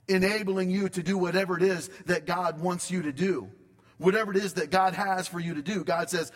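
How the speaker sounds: ordinary delivery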